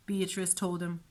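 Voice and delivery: ordinary delivery, nothing marked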